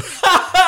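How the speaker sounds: loud, high burst of imitated laughter; mock belly laugh. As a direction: imitate dad cracking up loudly. Imitates dad laughing at fall.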